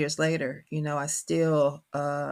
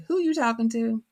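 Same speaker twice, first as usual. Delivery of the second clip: higher-pitched mock questioning; imitating husband; 7 semitones higher, 3 dB louder than usual. Husband noticing her twang on the phone, mock questioning. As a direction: lightly play a husband calling her out.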